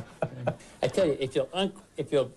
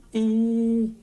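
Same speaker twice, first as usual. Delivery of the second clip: high ee sound; 11 semitones higher, 6 dB louder than usual. Shown as a demonstration. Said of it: "Say what?".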